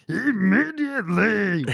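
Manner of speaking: big monster voice on a drawn-out word; Cookie Monster. Acted. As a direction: growl it out like a puppet monster. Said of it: "Big Cookie Monster".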